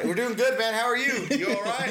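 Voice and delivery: friendly mock-concern. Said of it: "they're like".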